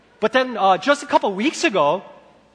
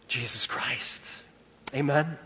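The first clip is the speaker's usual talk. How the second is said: ordinary delivery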